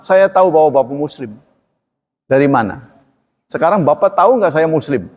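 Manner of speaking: normal talking voice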